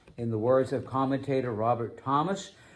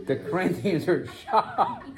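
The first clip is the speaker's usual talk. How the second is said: laughing; 11 semitones higher than usual. Real, not acted.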